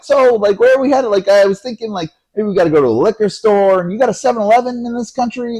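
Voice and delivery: high, loud, over-eager chatter; trying to be friendly. Retold or imitated. Teen trying to be buddies with the cab driver, over-friendly. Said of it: "I'm trying to be friendly".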